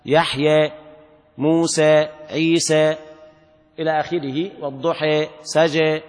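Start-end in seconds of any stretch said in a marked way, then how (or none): 1.4-3.0 موسى and عيسى ending on e, muse, ʕise; موسى وعيسى بالإمالة; imala demo. Shown as a demonstration. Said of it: "أمال حمزة والكسائي". 4.6-5.3 والضحى ending on e, waduħe; والضحى leaned toward e; والضحى بالإمالة; imala demo. Shown as a demonstration. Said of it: "أمال حمزة والكسائي".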